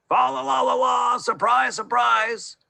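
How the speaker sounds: high, loud sing-song shout; joyful shout. A narrator's reading. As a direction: burst in singing surprise. Gardener bursts in singing.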